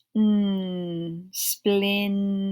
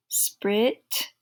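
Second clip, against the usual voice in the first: ordinary delivery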